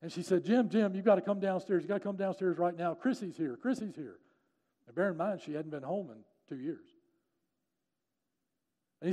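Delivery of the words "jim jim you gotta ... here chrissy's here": high, loud rush of news easing off; breathless-paced retold urgent call; urgent wife. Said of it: "suddenly his wife burst into the bathroom".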